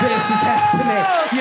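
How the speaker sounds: very high, slow mock wail; passengers' disbelief, drawn out. Passengers watch the fight on the night bus, high drawn-out disbelief. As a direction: stretch it out high as a stunned onlooker. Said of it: "everyone was sitting there like".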